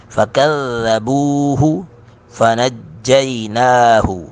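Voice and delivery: slow melodic tilawat; Quran recitation. Acted. Teacher reciting the verse for students, melodic. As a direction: chant it slowly in recitation mode.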